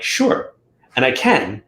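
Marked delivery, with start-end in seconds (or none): 0.0-0.5 high, airy whispered "sure"; breezy mock agreement. Reader answering a client's request, breezy whisper. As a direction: toss off an easy yes. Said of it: "I'm like".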